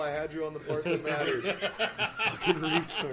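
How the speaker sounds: laughing fake rant; mock angry. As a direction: scold through laughter.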